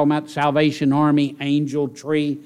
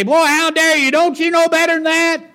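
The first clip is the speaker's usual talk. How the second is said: high, loud mock rebuke; mock outraged scolding of a brother; 14 semitones higher, 5 dB louder than usual. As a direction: snap at him like a big brother who caught you. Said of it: "I can jump on him and say".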